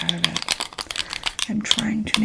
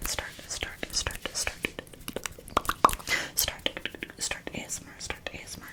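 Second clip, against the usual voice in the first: ASMR whisper; 7 dB quieter than usual. Real, not acted. Repeated trigger whisper.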